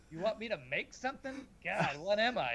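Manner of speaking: high-pitched mock complaint; hammed-up high whine of protest; imitated sarcasm. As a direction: parody a put-upon grumbler. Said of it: "like it was lathered in sarcasm".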